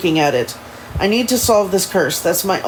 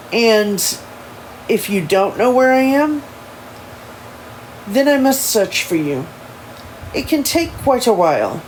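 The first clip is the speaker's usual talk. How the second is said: put-on higher-pitched proper English accent; measured, formal English accent with pauses; careful amateur English accent; Elijah; 4 semitones higher than usual. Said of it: "in his proper English ins-, inspired accent".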